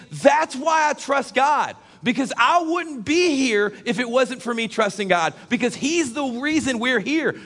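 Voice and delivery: high, drawn-out preacher's proclamation; forceful preaching. Acted. Preacher testifying to the congregation why he trusts God, forceful preaching.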